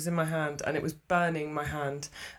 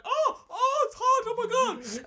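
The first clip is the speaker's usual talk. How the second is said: much higher, panicky lady voice; flustered lady. Retold or imitated. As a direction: squeal like a scalded woman. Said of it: "one lady standing there being like".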